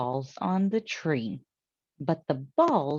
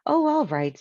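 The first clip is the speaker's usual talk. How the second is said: high, louder resigned sigh of a reply; reluctant giving in; 10 semitones higher, 5 dB louder than usual. Acted. Missus Rogers, talked round, gives in high and a bit louder. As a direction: give in with a huff.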